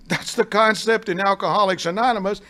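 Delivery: normal talking voice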